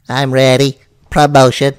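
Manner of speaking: eager cartoon sponge; SpongeBob voice. Shown as a demonstration. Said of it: "can I do SpongeBob".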